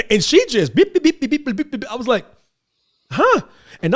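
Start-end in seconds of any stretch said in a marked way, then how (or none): 0.7-1.7 high-pitched rapid beeping noise; mimicked chatter beeps. 3.1-3.4 high-pitched incredulous query; mock disbelief. Reacting to shocking talk at dinner.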